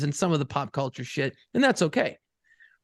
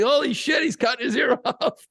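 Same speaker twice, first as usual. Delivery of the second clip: high-pitched, laughing mock exclamation; imitating hysterical amazement; 7 semitones higher than usual. Dad imitating his son at the ear scene, hysterical amazement. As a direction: act out a kid cracking up in disbelief. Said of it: "He thought it was fucking hysterical".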